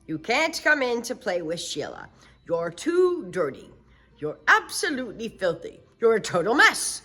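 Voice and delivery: loud scolding turning to shock; father realizing it's his daughter; stern father. Acted. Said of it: "Her father opened the door and said".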